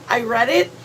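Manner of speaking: normal talking voice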